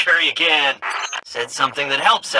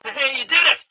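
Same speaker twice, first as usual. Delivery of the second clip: excited cheer; 13 semitones higher than usual. Acted. Helper cheers the player's success.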